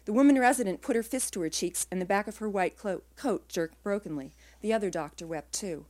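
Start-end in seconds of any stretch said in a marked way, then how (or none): none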